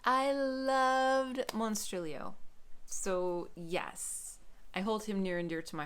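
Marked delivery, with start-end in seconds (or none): 0.0-2.3 gushing. Real, not acted. Gushing over favorite book.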